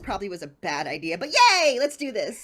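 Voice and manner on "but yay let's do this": too much caffeine. Real, not acted. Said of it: "I've had five cups of coffee today".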